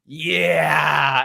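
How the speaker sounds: long, drawn-out shouted cheer; exploding cheer. Retold or imitated. Fan retelling the three count, exploding cheer. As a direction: let out a retold fan's roar. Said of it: "I exploded. And I was just like".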